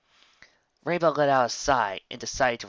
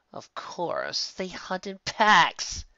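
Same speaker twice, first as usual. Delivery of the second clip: slow, dragged groan; moaning; 7 semitones higher, much slower than usual. Acted. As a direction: despair at the pack. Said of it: "Twilight moaned".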